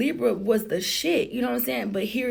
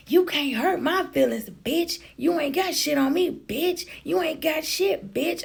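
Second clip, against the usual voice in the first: higher, heated retold insults; hammed-up sister snapping back; mock angry sister; 7 semitones higher than usual. Sister taunts narrator in the car, mock angry and high.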